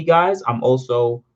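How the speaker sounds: ordinary talk, nothing marked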